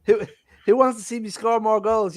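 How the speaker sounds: mock Ronaldo. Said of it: "I'll be like".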